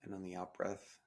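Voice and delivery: soft, calm meditation guide voice; guided meditation. Acted. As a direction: ease the listener into the breath, gently. Leads a breathing meditation.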